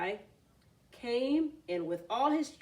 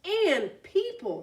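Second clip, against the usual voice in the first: excited; 8 semitones higher, 6 dB louder, slower than usual. A narrator's reading. Frog announcing wonders.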